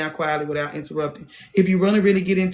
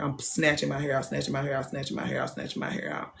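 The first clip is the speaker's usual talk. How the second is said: fading repetition; 3 semitones lower, 9 dB quieter than usual. Retold or imitated. Repeating, fading to nothing.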